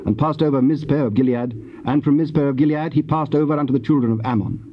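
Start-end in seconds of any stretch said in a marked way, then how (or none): none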